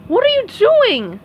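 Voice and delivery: high, loud horrified question; alarmed shout. Acted. Patrick shocked as SpongeBob opens the toy, loud. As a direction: demand an answer in shock.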